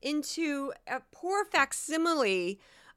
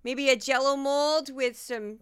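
hammed-up Midwestern accent, louder; 4 dB louder than usual. Podcaster from Missouri making fun of herself, comic Midwestern accent. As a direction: put on a playful Minnesota-Wisconsin accent. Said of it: "attempt to do an accent".

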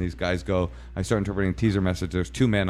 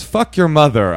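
high, loud quoted outburst; raised-voice retold insult; loud relayed insult; 7 semitones higher, 8 dB louder than usual. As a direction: relay the insult at full volume. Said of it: "she says".

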